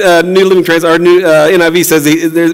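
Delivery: ordinary talk, nothing marked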